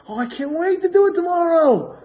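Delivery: put-on high, loud, eager kid voice; excited child. Child looking forward to tomorrow, excited. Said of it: "Tomorrow she gets excited".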